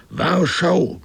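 a high, far-carrying shout of one word. Acted. Singleton shouting into the storm, a high, loud cry. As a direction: cry it out high against the roaring sea. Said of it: "schrie Singleton".

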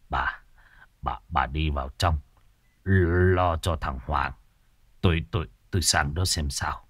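low, soft stammering voice; shaken and quiet. Acted. Mr Hỏa lifting his fainting wife, soft shaken stammer. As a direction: murmur to your wife, stunned.

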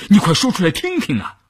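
high-pitched, eager demand; impatient urging. A narrator's reading. Impatient character demands to hear the plan. (提高嗓音急切催问)

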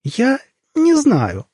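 very high, slow squeaky answer; thin little voice. A narrator's reading. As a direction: stretch it out in a tiny, diplomatic falsetto. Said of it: "тоненьким голосом ответил Лёша".

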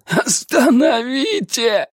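high, slow desperate shout; breaking cry. Acted. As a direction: beg at the top of his voice. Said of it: "срывающимся голосом закричал он".

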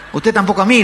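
high, loud comeback; acted annoyed woman; snappy retort. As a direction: fire it straight back. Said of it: "le contestó una mujer".

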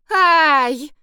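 high, loud annoyed "ай". A narrator's reading. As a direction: brush it off with irritation. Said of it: "Саша досадливо махнула рукой". (досадливое «ай»)

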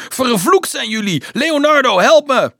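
very high, loud cry for help; shrieking. A narrator's reading. Dolf cursing his captors, calling Leonardo, shrieking. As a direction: shriek a curse, then yell for help. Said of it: "krijste hij".

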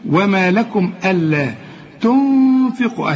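slow recitation, long nasal hum on the noon of تنفقوا; drawn-out ghunna. Shown as a demonstration. Teacher shows the ghunna in تنفقوا, humming the noon long. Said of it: "عايزة غنة هنا".

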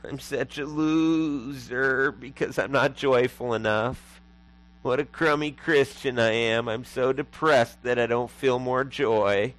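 slow, drawn-out self-pitying moan; mock guilty. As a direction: wallow in guilt, stretching the words out. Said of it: "beginning to feel guilty".

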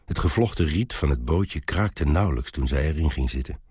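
ordinary delivery

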